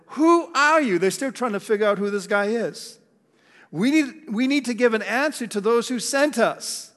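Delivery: lightly voiced priests pressing John; questioning priests.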